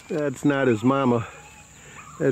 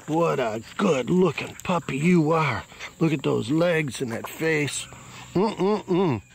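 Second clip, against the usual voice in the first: softer, higher cooing praise; cooing over puppy; 4 semitones higher, 3 dB quieter, slower than usual. Real, not acted. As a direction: coo softly at the handsome pup.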